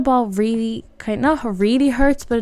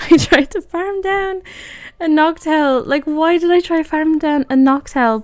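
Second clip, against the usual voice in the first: much higher, louder disbelief at own mistake; exasperated self-mockery; 8 semitones higher, 4 dB louder than usual. Real, not acted. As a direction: squeak it out in mock disbelief.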